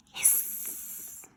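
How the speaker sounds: breathy whispered hiss; cat hiss. Acted. Cats hissing.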